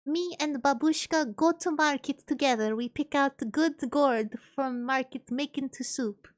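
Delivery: put-on Russian accent, deliberate phrasing; cute Russian accent. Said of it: "I am a cute Russian Sony".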